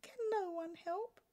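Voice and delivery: a gentle king asking meekly; small voice. Acted. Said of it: "he added in a small voice".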